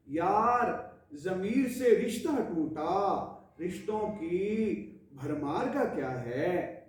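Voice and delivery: ghazal recitation. Acted. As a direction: recite each couplet with measured pauses. Recites own ghazal to viewers.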